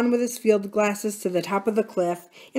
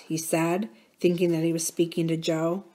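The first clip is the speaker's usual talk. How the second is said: usual speaking voice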